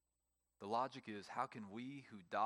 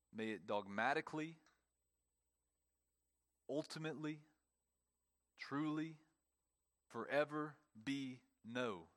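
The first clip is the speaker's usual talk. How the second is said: each word pressed higher and hit hard; emphatic no; 3 semitones higher than usual. Shown as a demonstration. Preacher hammering home Paul's answer, emphatically. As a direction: pile up the adverbs, punching each one. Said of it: "emphatically no".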